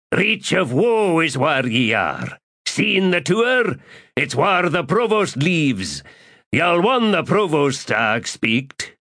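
put-on a frightened innkeeper in a South Islander accent. A narrator's reading. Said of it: "the accent South Islander".

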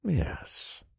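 soft, confident yes; sure grin. A narrator's reading. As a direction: say it softly through a grin. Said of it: "I said with a sure grin".

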